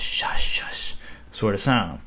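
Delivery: "shush, shush" whispered slowly; hissed shush. Shown as a demonstration. Teacher whispers "shush" to show the sh sound. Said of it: "kind of like shush".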